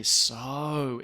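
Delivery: "so" said long, with emphasis; emphatic "so". Shown as a demonstration. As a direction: draw out "so" to stress it. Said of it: "it's a very emphatic thing".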